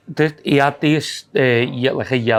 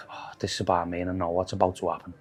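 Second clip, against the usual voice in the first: hushed dread; 7 semitones lower, 12 dB quieter, faster than usual. Retold or imitated. Dread before the fight.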